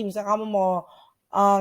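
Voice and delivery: ordinary delivery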